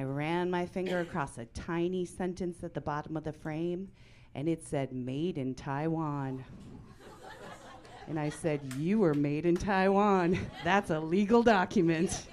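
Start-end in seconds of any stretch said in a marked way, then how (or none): 4.9-6.4 slow, low read-out; deliberate reading. Retold or imitated. Reads out the frame's tiny print. 8.7-12.3 loud, triumphant verdict on a sibling; mock accusation. Triumphant accusation to her sister.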